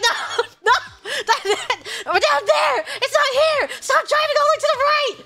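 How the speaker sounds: high, frantic correcting; exasperated scolding. Retold or imitated. Daughter correcting mom drifting to the right, exasperated. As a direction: scold in a high pitch, half laughing. Retold quarrel with driver mom.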